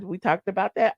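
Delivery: ordinary talk, nothing marked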